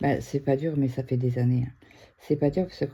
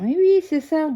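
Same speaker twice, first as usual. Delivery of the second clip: high, loud mocking "oh sure"; sarcastic disbelief; 13 semitones higher, 8 dB louder than usual. Woman imagines viewers scoffing at her, mocking. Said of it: "Vous allez me dire".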